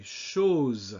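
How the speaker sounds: chose as shohz, closed pure O; long rounded vowel in French chose; French pronunciation model. Shown as a demonstration. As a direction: model chose for the class to repeat. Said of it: "repeat after me".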